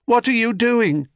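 high echo of the question. A narrator's reading. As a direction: repeat it back. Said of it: "said Echo".

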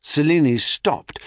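ordinary delivery

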